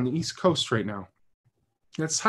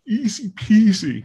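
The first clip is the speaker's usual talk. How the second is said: high, loud and slow, playfully sing-song; childlike playful lilt; 9 semitones higher, 6 dB louder, slower than usual. Acted. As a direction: toss it off like a nursery rhyme. Playful tag on voting point.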